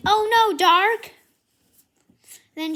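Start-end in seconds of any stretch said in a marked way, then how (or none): 0.1-1.1 high, loud cry of alarm; loud, high cry for a friend. Acted. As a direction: call out in shock. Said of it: "And then she's like".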